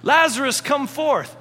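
loud, high command; commanding call. Retold or imitated. Jesus calling Lazarus from the tomb, loud command. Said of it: "just come and go".

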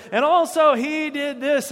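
high, loud tattling whine; mock accuser piping up loudly. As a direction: point the finger, outraged. Soldiers pointing fingers.

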